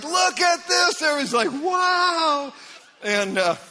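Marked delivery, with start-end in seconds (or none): 0.0-1.0 amazed showing off. Retold or imitated. Spies showing off huge grapes. 1.5-2.5 amazed wow. Retold or imitated. Everyone marvels at the fruit.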